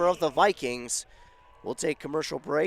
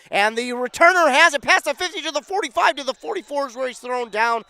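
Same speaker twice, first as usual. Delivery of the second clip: much higher and louder burst; excited return call; 10 semitones higher, 9 dB louder than usual. Real, not acted. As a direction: lift with the long return. Long kickoff return call.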